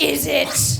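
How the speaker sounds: loud, rough-edged mock disbelief; hostile British retort. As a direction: throw it back as a grumpy Brit. Grumpy British reply to cheery greeting.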